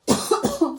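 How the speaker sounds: harsh loud cough; coughing fit. Real, not acted. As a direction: cough hard and sudden. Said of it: "my coughing and spluttering".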